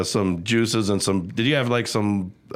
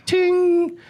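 guitar ting. Retold or imitated. Imitating guitar string sound.

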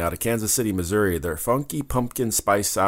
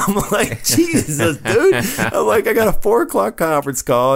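exasperated laughing disbelief; 12 semitones higher, 4 dB louder than usual. Retold or imitated. Exasperated retold reaction, laughing.

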